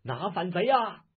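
rally the troops to charge; shouting. A narrator's reading. Officer urges his soldiers on, loud rallying shout. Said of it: "叫道".

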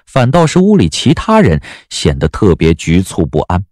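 ordinary talk, nothing marked

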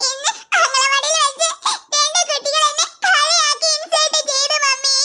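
put-on crying child telling on others; tearful complaint. Child complains to mother about being teased, crying. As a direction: sob between the phrases. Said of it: "എന്തിനാ നീ ഇപ്പൊ കരയുന്നേ".

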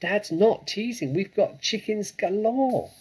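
high, loud, excited cubs; eager high-pitched boast; small foxes crying. A narrator's reading. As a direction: pipe up in a high youngster voice. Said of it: "cried the small foxes".

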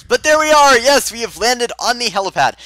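high, excited cry of success; triumphant. Real, not acted. Gamer announcing he has landed on the helipad, triumphant. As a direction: burst out high and loud, then ease slightly.

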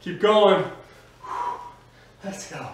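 normal talking voice

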